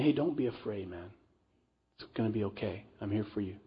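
soft, hushed comfort; gentle reassurance. Acted. Joseph comforting his frightened brothers, softly reassuring. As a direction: ease their fear like a big brother.

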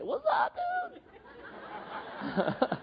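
squeaky, high mock "What's up, dude"; mock gangster greeting. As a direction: toss off a silly wannabe greeting. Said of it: "and say".

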